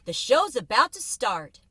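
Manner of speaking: loud, eager spectator; calling out. A narrator's reading. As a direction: shout it to the crowd.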